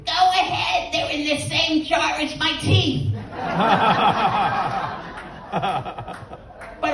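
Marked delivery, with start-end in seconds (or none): 0.1-3.1 put-on high-pitched old-man comeback; defiant old man. Frank answers the nursing home worker's threat, high and defiant. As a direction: toss it back high, as the cheeky old patient.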